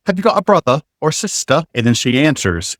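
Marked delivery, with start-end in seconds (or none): 0.1-1.6 put-on British RP, higher pitched; fake received pronunciation. Asking a girl for a second try, in fake RP. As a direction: imitate a fake English RP accent. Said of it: "I spoke with a fake received pronunciation".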